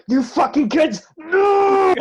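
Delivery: loud, high furious yell; angry shout. Retold or imitated. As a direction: bellow it at the kids. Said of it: "A grown man actually growled at us".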